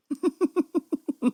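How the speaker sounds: high bursting laugh; a real laugh breaking out high; a quick high giggle. Real, not acted. Laughing at the film's ending.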